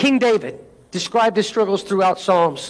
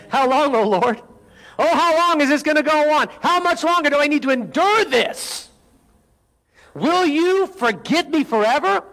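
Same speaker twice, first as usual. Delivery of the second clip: pleading psalm reading; 7 semitones higher than usual. Acted. Reads psalm as anguished plea.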